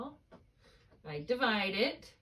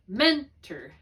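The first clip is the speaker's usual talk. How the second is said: "mentor" as MEN-ter, first syllable loud and higher; stress on first syllable; 4 semitones higher, 7 dB louder than usual. Shown as a demonstration. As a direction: punch MEN in "mentor", reduce the end to "er". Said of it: "an er sound".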